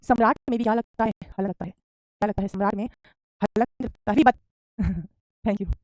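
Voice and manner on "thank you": shy laugh. Real, not acted. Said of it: "Sharma रही है".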